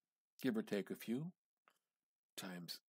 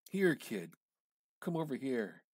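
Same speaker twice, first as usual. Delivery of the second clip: calling out; 4 semitones higher, 8 dB louder than usual. Acted. Sick man calls the kid.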